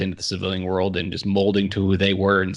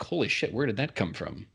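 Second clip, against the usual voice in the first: soft, stunned aside to himself; under-voiced self-surprise; hushed astonishment; 8 dB quieter than usual. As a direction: drop the volume in quiet amazement. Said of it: "And I was like".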